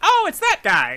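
high hopeful prisoner voice; satyr voice. Acted.

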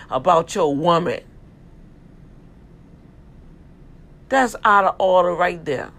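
sermon swelling into a loud rebuke; preaching building to a shout. Acted. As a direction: preach it up until you're shouting.

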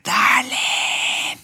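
high, ecstatic shout of a pet name; joyful shout. Retold or imitated. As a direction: yell it while running with joy. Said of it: "Y empiezo a correr". (grito de alegría muy agudo, corriendo por la calle)

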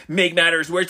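ordinary delivery

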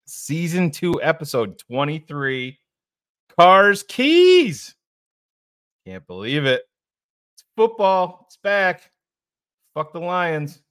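loud, high, hyped show opener; hype intro. Real, not acted. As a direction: fire up the crowd at the top.

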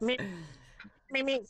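high-pitched cartoon bird beep; Road Runner. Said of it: "road runner from Road Runner Coyote".

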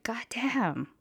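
put-on whispered in drunken awe; awed whisper. Tipsy on the bench, gazing at boyfriend, whispered. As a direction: breathe it out in a hush. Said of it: "I was like".

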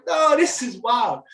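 slow, stunned joy over twins; amazed disbelief. Retold or imitated. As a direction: let the wonder stretch out. Reacting to having twins.

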